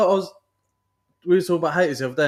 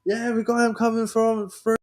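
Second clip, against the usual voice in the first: high, excited mock cheer; hamming up the watching army lads; mimicking hyped soldiers; 9 semitones higher, slower than usual. Recalling army spectators cheering him on, high mock cheer. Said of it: "Oh, yeah".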